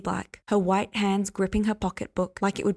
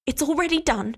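higher triumphant shout; 7 semitones higher than usual. Acted. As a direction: throw it at him in triumph. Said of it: "she shouted at him".

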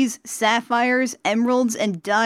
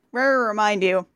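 ordinary delivery, nothing marked

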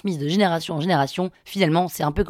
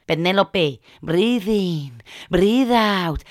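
slow breathing instruction, Indian English; calming Indian-accented healer; much slower than usual. Retold or imitated. Healer calms panicking patient, slow Indian accent. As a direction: soothe her breathing.

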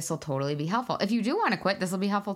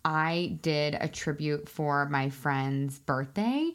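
normal talking voice

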